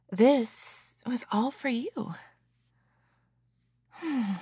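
hushed, teasing and tender. Acted. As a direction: murmur it soothingly afterward. Post-climax aftercare roleplay.

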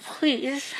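high muffled plea through a gag. Acted. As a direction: say it around a mouthful of cloth. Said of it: "it came out muffled".

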